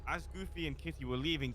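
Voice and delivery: ordinary talk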